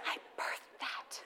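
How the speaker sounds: whispered aside; breathy, amused under-the-breath remark. Real, not acted. Mom whispering to the audience about her son. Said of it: "whispered aside".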